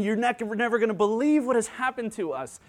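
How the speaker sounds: excited, tumbling delivery. Acted. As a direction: burst into the room with amazing news. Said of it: "He's so excited".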